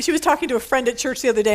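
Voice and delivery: ordinary talk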